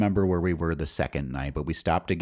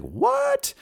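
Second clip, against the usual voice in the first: a sudden, much higher "what?"; disbelief. Real, not acted. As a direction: jump up in pitch, can't believe the price. Disbelief at the cheap rent.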